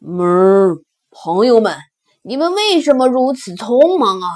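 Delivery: big ox booming a question; loud bison voice. Acted. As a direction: open with a loud moo-like hum, then ask loudly. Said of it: "野牛大声问". (野牛般大声询问)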